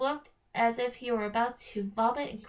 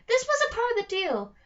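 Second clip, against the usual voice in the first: loud, much higher furious yell; troll princess screaming from the cell; 10 semitones higher, 6 dB louder than usual. Acted. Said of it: "Trobella screamed".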